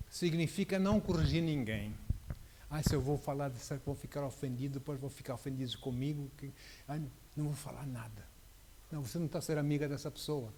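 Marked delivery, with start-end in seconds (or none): 2.7-8.3 mock timid believer. Preacher mocking a timid believer who avoids correcting. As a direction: whine it like someone afraid to offend. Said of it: "Algumas pessoas acreditam que andar em amor".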